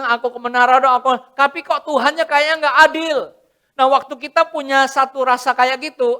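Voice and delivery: loud, high preaching with a complaining believer voice; impassioned preaching. Acted. Preacher voicing a believer complaining to God, loud and high. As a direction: preach hard, then whine like the aggrieved believer.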